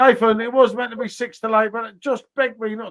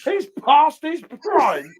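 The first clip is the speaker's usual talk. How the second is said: mock indignant repeat; 5 semitones higher, 4 dB louder, much slower than usual. Repeats jibe after laughing.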